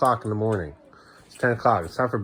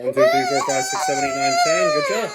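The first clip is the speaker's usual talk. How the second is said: sing-song counting; 4 dB louder than usual. Real, not acted. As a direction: cheerfully count out the shot, pitch way up. Counting during child's insulin shot.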